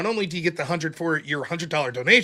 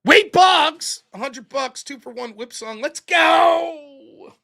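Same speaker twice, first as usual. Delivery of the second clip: hyped shoutout; 6 semitones higher, much slower than usual. Real, not acted. Streamer hyping a donor's two-for-one whip song, excited. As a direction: pump up the chat over a big donation.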